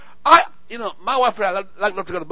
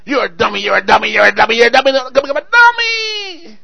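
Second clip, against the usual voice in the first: a mild mock of a nagging parent, rising louder to a cry; scolding parent; 4 semitones higher, 8 dB louder than usual. Parent berating a child, mock nagging that builds louder. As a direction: repeat the insult, building to a high shrill-free cry.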